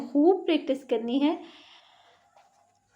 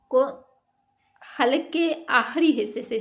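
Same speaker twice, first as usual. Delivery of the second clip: ordinary delivery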